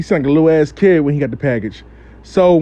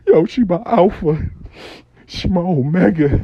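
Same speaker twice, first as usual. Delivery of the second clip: mock tearful. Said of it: "yo, you want me to cry".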